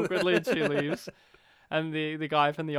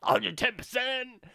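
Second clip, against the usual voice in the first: dumb guy voice; 12 semitones higher than usual. Retold or imitated. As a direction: play the clueless jock stalling. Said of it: "he does a dumb guy voice".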